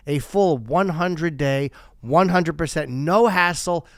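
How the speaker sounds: ordinary delivery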